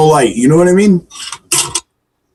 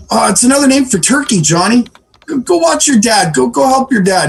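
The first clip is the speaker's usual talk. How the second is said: higher-pitched mock-mom improvising; flustered mom; 8 semitones higher than usual. Mom sending Johnny off to his dad, flustered high voice. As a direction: cover up, then shoo the kid away. Mom fobs off curious child.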